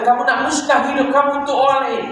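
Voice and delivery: high-pitched, urgent retold rebuke; earnest quoted reprimand, pitched high; reproving. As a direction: reprove insistently, voice pitched well up. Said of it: "Allah tegur".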